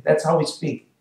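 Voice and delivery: normal talking voice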